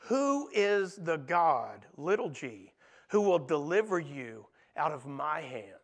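lightly voiced, mocking king; king's taunt. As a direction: drag it out and sneer down at them. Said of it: "look what he says".

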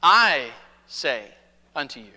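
a hint of Jesus's authoritative voice; Jesus speaking with authority. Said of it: "he's saying things like".

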